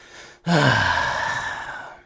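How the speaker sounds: long, loud exhale; exasperated sigh. Real, not acted. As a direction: let out a big sigh at the stubborn camera. Camera will not focus.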